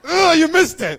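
high, loud exasperated cry; loud groan. Real, not acted. Preacher scolding believers who miss their chance, loud groan. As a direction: shout it in frustration.

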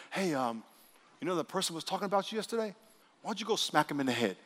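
soft, casual sly suggestion; small inner voice. Acted. Preacher voices a false prompting to smack someone, small quiet voice. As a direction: quietly slip the idea in, like a passing thought. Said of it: "If you ever hear a small, still voice".

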